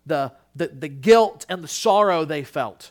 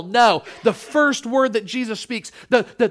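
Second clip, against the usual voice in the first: ordinary talk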